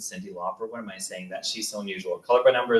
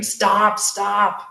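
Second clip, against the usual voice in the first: high-pitched called-out stop; mock scream; 10 semitones higher, 4 dB louder than usual. Kids yelling at a passing cyclist, acted scream. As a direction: cry out a warning, pitch jumping up. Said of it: "have to scream".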